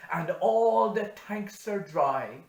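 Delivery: loud, high rising lament; doom-laden farmer. Acted. Hanrahan warning of drought, loud rising lament. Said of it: "he said".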